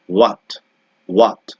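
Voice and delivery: ordinary talk, nothing marked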